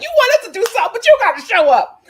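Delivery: mock viewers nagging. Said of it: "y'all like".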